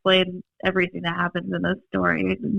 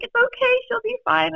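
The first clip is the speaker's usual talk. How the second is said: high, soothing fake calm; holding back tears to comfort them; reassuring the boys. Sister reassuring scared little brothers, high forced calm. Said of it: "I was like".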